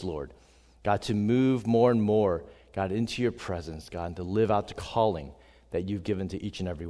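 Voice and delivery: hushed, earnest pastor prayer. Acted. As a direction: pray softly, trailing to a hush. Leads the opening prayer.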